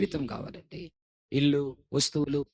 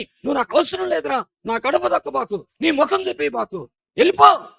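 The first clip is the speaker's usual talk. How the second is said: much higher, louder harsh father driving son out; angry father; 14 semitones higher, 13 dB louder than usual. Retold or imitated. Angry father banishing his son, loud shouted rejection. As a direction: bark the father's banishment, ending in a yell.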